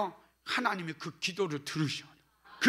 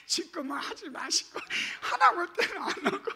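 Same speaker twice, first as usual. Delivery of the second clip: teasing complaint; 8 semitones higher than usual. Real, not acted. Teasing the congregation.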